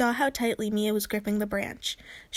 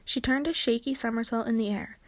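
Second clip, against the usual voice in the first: plain reading voice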